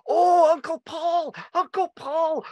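put-on squeaky excited kids; wee cousins. Imitating small cousins greeting their famous uncle, high and eager.

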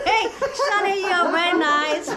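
high, slow, giggling line; giggling attempt. Auntie trying the phrase she was taught, giggling. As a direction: laugh through the line. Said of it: "Go, go".

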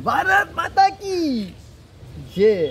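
very high, slow, drawn-out slogan; loud slogan demo. Shown as a demonstration. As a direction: show the kid how to shout it. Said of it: "ऐसे जोर से बोल". (ज़ोर से खींचकर बोला गया नारा)

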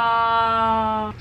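long, sustained sympathetic "aww"; touched aww, stretched right out. Real, not acted. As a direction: hold one drawn-out aww. Reacts to friend's tearjerker song choice.

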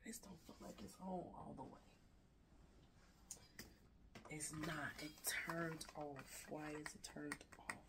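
very quiet talking to oneself; muttered aside. Real, not acted. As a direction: drop to a near whisper, puzzling over a device. Checks device, talking to herself aside.

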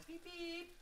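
high playful beep beep like a car horn; cheeky imitated horn honk; mock horn beeps. Shown as a demonstration. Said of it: "I'm gonna toot my own horn".

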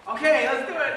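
normal talking voice

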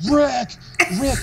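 high, loud mock scream of a name; imitated screaming. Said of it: "He just started screaming my name".

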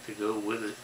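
ordinary talk

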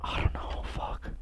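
faint unvoiced whisper. Real, not acted. Frightened player whispering to himself, hushed. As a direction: breathe the words so nothing hears.

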